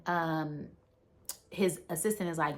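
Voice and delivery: normal talking voice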